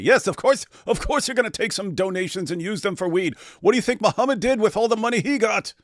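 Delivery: high-pitched mock justification; sarcastic agreement. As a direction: argue it as if perfectly obvious. Sarcastically voices the excusing mentality.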